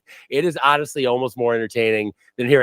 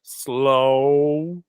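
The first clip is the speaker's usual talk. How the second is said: mock slow talk; 7 semitones lower, much slower than usual. Podcaster mimicking Dallas hosts, painfully slow and low. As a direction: imitate extremely slow, low talkers. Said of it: "It was just slow".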